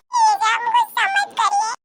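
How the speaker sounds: put-on very high, small child's plea; pleading child. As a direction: beg her not to be angry like a little kid. Child pleads with angry aunt. (डरी हुई बच्ची की विनती)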